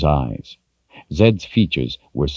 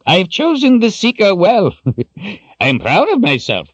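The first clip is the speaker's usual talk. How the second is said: high, louder boast broken by a laugh; proud chuckle; 4 dB louder than usual. Acted. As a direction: crow about it, grinning. Zed grinning, self-congratulating.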